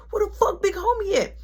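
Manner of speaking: angry yell. Retold or imitated. On the phone to his friend, a furious high yell. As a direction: snap at him, fed up. Yelling about absent Big Homie.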